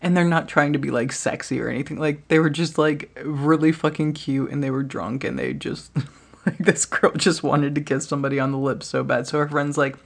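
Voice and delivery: usual speaking voice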